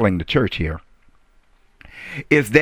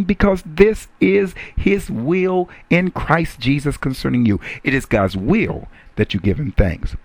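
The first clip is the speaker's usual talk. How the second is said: usual speaking voice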